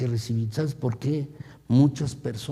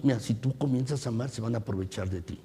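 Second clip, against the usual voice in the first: low, hushed, quick voice of the tempter; a sly, hushed tempter voice; voicing the evil one; 3 semitones lower, 7 dB quieter, faster than usual. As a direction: whisper the devil's lie quickly. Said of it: "¿sabes lo que dice el maligno".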